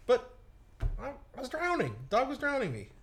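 a whiny hint of indignation; mock protest. Real, not acted. Protesting he really was drowning.

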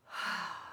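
soft breathy contented sigh; a dog's contented sigh; imitated dog sigh. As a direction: let out a little snuggly sigh. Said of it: "and he does the little".

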